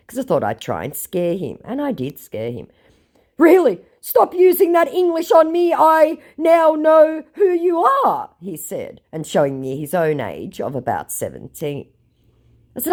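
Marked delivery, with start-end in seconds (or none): 3.4-8.3 mock angry shout. The scammer shouting back at the narrator, high and loud. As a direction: bark it back in a huff.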